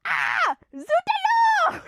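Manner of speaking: cartoon French exclamation; over-the-top mock French cry; fake French. Shown as a demonstration. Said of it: "the fake French thing that they say in kids' cartoons".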